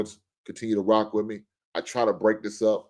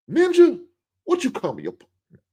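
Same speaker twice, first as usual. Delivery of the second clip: high loud yelp, then drops back; mock offended yell; 6 dB louder than usual. Wife snapping back at her husband, high mock yelp. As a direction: squeal it like someone insulted. Second mock outraged reply.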